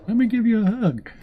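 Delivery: very high, loud sugary offer; Navy seal offering the kids a hug, mock sweet; sweet soldier. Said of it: "Navy seals would probably be like".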